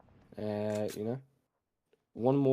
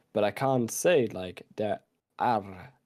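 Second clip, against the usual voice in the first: ordinary delivery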